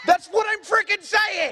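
high-pitched exasperated yell; exasperated shout. Real, not acted. Host protesting the pronunciation correction, exasperated high shout. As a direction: cry out in sheer exasperation.

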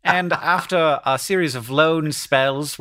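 put-on slow British newsreader accent; measured mock-BBC English accent. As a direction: read it out slowly in BBC English. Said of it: "this is like B B C voice".

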